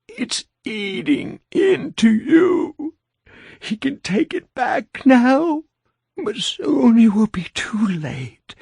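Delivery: high, slow ghostly voice rising to a shout; eerie undead voice. A narrator's reading. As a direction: drag it out like a ghost. Narrator voices undead woman warning.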